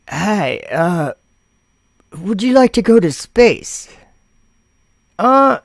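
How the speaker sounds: high, put-on caller voice. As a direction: play a cheery caller. Caller in the phone skit.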